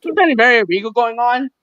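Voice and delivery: high-pitched, goofy skit exclamation; mock shocked onlooker. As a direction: squeak it out in pretend shock. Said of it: "gonna be like".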